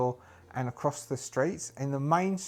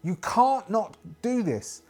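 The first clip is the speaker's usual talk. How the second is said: raised, higher voice pleading after someone fleeing; priestess calling out; 6 semitones higher, 5 dB louder than usual. Acted. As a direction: call out urgently, drawing out the plea. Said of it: "the priestess, uh, calling".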